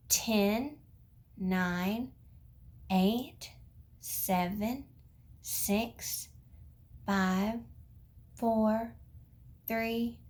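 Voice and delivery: put-on slow rocket countdown for kids; teaching a child to count. Shown as a demonstration. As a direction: count down slowly to liftoff. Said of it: "I want you to count out loud".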